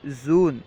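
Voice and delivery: جون said as زون, opening on a z; Persian pronunciation. Shown as a demonstration. Teacher showing students the Persian for June, Persian pronunciation. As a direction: say زون with z for j and a long oo. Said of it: "فارسی تلفظ میں اس طرح کہیں گے".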